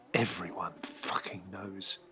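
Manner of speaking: hissed, voiceless aside; bitter whisper. Acted. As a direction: spit it out under your breath.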